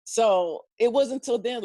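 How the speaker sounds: usual speaking voice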